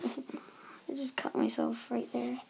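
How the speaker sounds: real breathy, slow near-whisper after a fall; pained near-whisper. Real, not acted. Vlogger telling viewers about a cut, pained whisper. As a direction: whisper it slowly, hurting. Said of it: "that was kinda painful".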